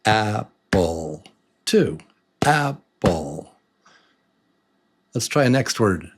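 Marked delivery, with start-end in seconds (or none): 0.1-3.5 Apple broken into two separate beats; counting out syllables. Shown as a demonstration. Teacher checking syllables in apple, each beat set apart. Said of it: "syllables in apple".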